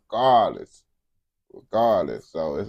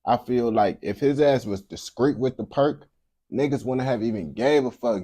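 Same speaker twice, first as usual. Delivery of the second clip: normal talking voice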